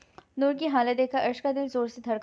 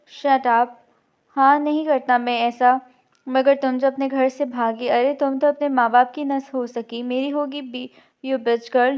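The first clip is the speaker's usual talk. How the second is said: loud, contemptuous snapping at her; angry outburst; 8 dB louder, slower than usual. Acted. Arsh lashes out at Noor before the crowd, loud and angry. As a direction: cut her down in front of everyone.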